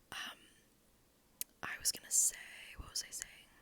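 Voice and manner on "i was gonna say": normal talking voice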